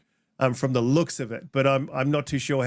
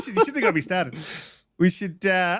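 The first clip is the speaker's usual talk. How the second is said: laughing; 3 semitones higher than usual. Real, not acted. Laughing at own rant.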